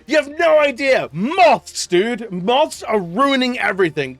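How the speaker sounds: high, loud mock rant; loud, high comic rant about moths. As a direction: shout it like a fed-up ranter. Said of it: "and Lux just goes".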